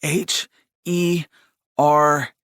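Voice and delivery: effortful spelling. A narrator's reading. Anxious brother spelling it out for his brother, effortful. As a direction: push out each letter separately, at a cost. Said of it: "spelled with some effort, as if pronouncing each letter at an emotional cost".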